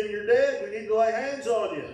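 normal talking voice

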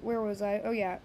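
ordinary talk

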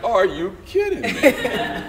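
mock outrage dissolving into laughter; laughing disbelief. Disbelief at chauffeur duty.

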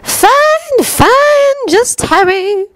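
very high-pitched dismissive villainess. A narrator's reading. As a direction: wave it away in a high voice. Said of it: "Bellatrix said dismissively".

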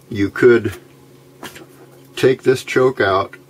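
ordinary talk

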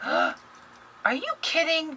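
can't believe the gift; stunned disbelief. Real, not acted.

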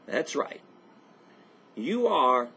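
ordinary talk, nothing marked

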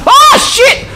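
high, loud, real shout of alarm; startled shout. Real, not acted. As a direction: yelp it as she whips around at you. Enemy suddenly turns and charges.

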